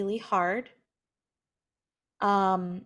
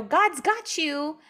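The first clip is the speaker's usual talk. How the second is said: glib platitude; 6 semitones higher, 4 dB louder, slower than usual. Retold or imitated. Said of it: "you know".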